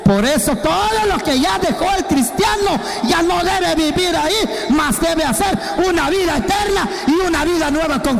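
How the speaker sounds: high, driving pulpit voice; fiery preaching. Acted. Preacher urging believers to a new life, fiery. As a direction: hammer the point home.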